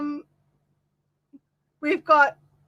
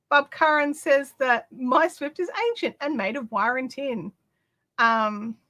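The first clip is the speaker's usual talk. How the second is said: normal talking voice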